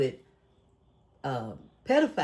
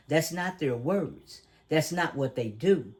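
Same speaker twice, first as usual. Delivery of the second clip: ordinary talk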